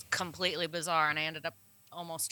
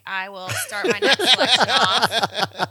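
slow, louder mock Waterboy drawl; a hint of a slow Waterboy voice, louder; Bobby Boucher voice; 2 semitones higher, 7 dB louder than usual. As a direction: drag it out like Bobby Boucher, lightly hammed. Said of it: "Bobby Boucher".

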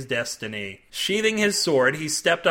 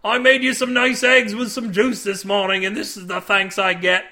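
high, loud wounded complaint; aggrieved cook; 5 semitones higher, 5 dB louder than usual. A narrator's reading. Older cook to the naked man, aggrieved. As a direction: scold an ungrateful shipmate.

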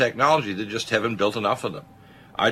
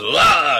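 a short loud mock dog bark; sudden imitated dog lunge bark; 5 semitones higher, 7 dB louder than usual. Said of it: "And the dog goes".

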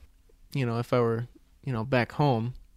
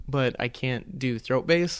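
ordinary delivery

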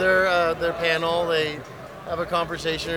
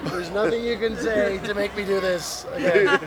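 hammy stage actor; 4 semitones higher than usual. Acted. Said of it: "I played King Richard".

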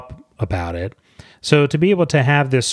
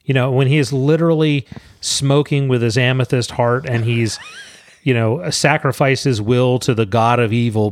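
ordinary talk, nothing marked

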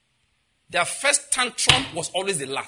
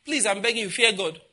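ordinary talk

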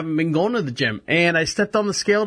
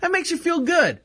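much higher, gushing gratitude; touched delight; 8 semitones higher than usual. Retold or imitated. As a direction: gush like a touched kid. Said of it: "I'm like".

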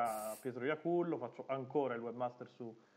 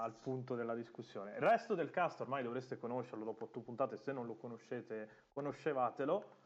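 ordinary talk

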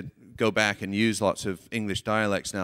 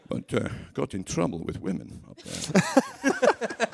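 put-on Glasgow accent with soft asides; Scottish accent; 7 semitones higher, 4 dB quieter than usual. Actor chatting to a fan from Glasgow, put-on Scottish accent. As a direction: play a chatty Scot reminiscing about Glasgow. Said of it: "Was that a Scottish accent".

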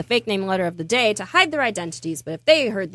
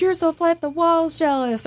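a higher, sneering schoolyard taunt, slowed on the punchline; mock bully taunt; 8 semitones higher than usual. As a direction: sneer it like a mean kid. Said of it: "they were like".

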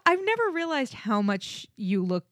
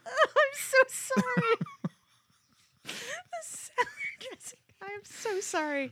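laughing; 13 semitones higher, 3 dB quieter than usual. Real, not acted. Laughing after a gaffe.

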